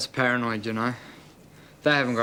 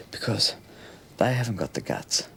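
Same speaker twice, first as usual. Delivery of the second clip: ordinary talk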